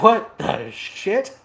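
high, drawn-out disbelief; high slow shocked. Retold or imitated. Dorn's Terminators finding the dead Primarch, high shocked cry. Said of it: "They're like".

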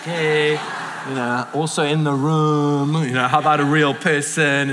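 drawn-out, pointed hinting; passive-aggressive. Acted. Spouse texting partner in the same room, passive-aggressive. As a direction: stretch the words into a needling hint. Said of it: "like, passive-aggressive moves".

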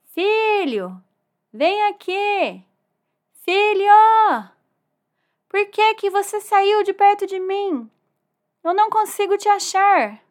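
high, loud, drawn-out calling; worried dad shouting into the distance; father calling out. Acted. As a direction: call out for a lost son. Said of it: "O papai dele chamava ele".

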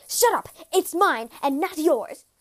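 loud, forceful cut-in; angry interruption. A narrator's reading. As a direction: shout the boy down to claim it. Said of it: "The impostor interrupted him and said".

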